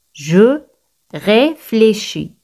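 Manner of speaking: "Je ... réfléchis", slow, with a pause after Je; slow demonstration. Shown as a demonstration. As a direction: draw out "réfléchis" slowly, separated from "Je". Teacher models phrase word by word.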